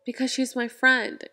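usual speaking voice

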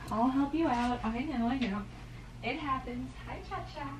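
fading hushed pet talk; soothing the cat. Real, not acted. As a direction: murmur gently to the cat.

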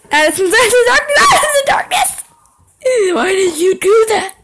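very high, loud terrified shouting; panicked scream. Real, not acted. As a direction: shriek it as the monster appears. Jump scare, panicked.

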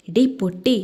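usual speaking voice

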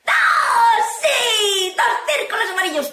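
loud high cheer, easing slightly; triumphant shout. Acted. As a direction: shout the win like a goal. Cheering a correct guess.

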